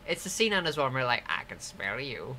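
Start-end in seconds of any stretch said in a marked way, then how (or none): 1.3-2.4 creepy villain voice. Retold or imitated. Quoting creepy game villain.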